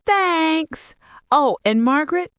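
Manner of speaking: high, chirpy thanks; cheerful. A narrator's reading. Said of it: "Olivia's cheerful voice".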